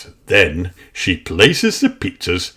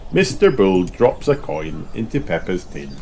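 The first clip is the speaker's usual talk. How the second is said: ordinary delivery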